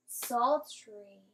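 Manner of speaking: Sultry said whole and clear; teacher blending the word for class; blended word. Shown as a demonstration. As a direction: blend it back into one word. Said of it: "Let's clap it out".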